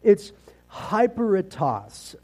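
hyperetas said the English way, slowly: hy-PER-e-tas; English pronunciation of Greek. Shown as a demonstration. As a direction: sound out hyperetas carefully in plain English sounds. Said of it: "I'm gonna say it with an English pronunciation".

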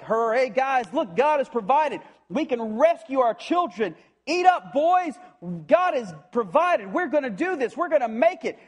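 high, loud, rousing cheer to the troops; excited Jonathan. Acted. Jonathan rallying the starving army, excited and loud. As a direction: shout it like a sudden sugar rush of joy. Jonathan finds honey, energy rush.

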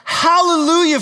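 high, loud hallelujah ringing out; a joyful shout of praise; triumphant cry. Acted. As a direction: proclaim it like a great crowd in heaven. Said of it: "crying out".

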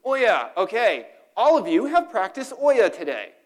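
high, loud, pumped-up cheer; excited rallying. Real, not acted. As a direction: fire up the room. Rallying the congregation.

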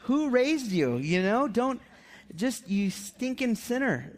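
high, playful exasperated scolding; mock exasperated parent. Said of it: "I'm like".

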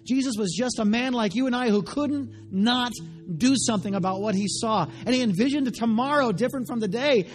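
full-force preaching. Acted. Preacher crying out to the congregation at full force. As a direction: preach it out to the back row.

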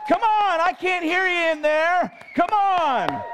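high, loud urging; rally them, loud and high; shouting. Real, not acted.

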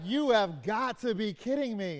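thrilled, stressing "You"; can't believe her luck; excited disbelief. Retold or imitated. Daughter reacting to her brand new car, overjoyed disbelief. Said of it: "two different stories where they have the same words".

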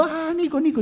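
put-on high, gushing hello to a child; delighted greeting. People greeting little Nico, high and gushing. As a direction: everyone lights up seeing Nico.

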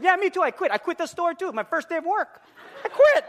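high, loud mock outburst; mock indignant quitting. Customer declaring his first day over, mock indignant shout. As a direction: build to a high exclaimed finish.

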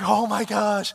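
retold panic at running late; panicked. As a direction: realize in alarm that time has run out.

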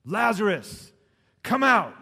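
calling out with full force; Jesus calls into the tomb; loud call. A narrator's reading. Jesus calling Lazarus out of the tomb, loud call. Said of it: "Jesus called out in a loud voice".

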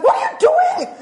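very high, loud exasperated yell; hammed-up outburst of reproach; blunt scolding. Speaker showing how she speaks to people, loud scolding. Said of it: "I speak like this".

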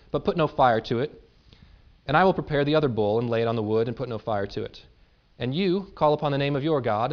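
measured, earnest Bible reading; dramatic scripture reading. Acted. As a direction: read the passage to the congregation with weight. Reads scripture aloud to congregation.

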